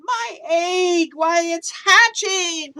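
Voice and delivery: high, loud, slow joyful shout. Acted. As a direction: call it out in wonder. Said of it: "shouted Horton".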